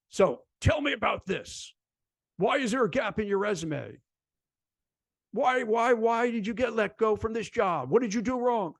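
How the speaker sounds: jerky interviewer. Retold or imitated. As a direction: play a cold interviewer grilling a candidate. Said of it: "Mister Jerky interview person".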